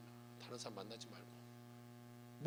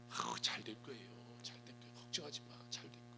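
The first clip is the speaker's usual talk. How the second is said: whispered reassurance; 6 semitones lower, 10 dB quieter than usual. Shown as a demonstration. Gentle encouragement to struggling person.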